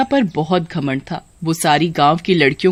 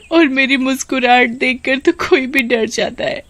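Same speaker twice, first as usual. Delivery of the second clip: high, tearful daughter's voice; acted weeping, pitched higher; crying; 5 semitones higher than usual. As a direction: sob it out to your mother. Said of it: "बहुत तेज तेज रोती है".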